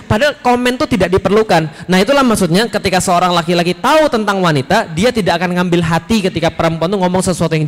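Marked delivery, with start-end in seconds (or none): none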